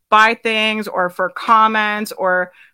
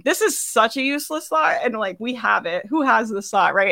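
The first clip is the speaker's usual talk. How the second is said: usual speaking voice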